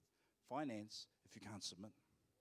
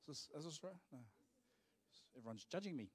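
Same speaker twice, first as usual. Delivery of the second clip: usual speaking voice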